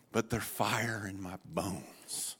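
quiet, burdened prophet; hushed lament. Retold or imitated. Lament sinks into heavy admission.

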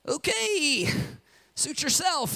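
high, breezy dismissive okay; shrugging rescuer. Retold or imitated. Rescuer gives up on the stubborn man, breezy shrug. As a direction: let it go with a shrug.

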